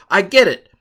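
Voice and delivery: a loud, high burst of mock revelation; sudden realization. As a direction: jolt awake as if it finally clicked. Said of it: "and you'll say".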